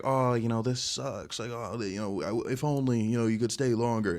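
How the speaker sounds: mock lament. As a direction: whine lightly, as if reluctant to part. Acting out a reluctant goodbye.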